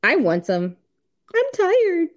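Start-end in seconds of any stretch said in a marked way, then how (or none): 1.3-2.1 high whiny excuse voice; mocking whiny partner. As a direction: whine it like a tired spouse. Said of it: "I get the same comment".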